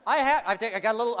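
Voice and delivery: ordinary talk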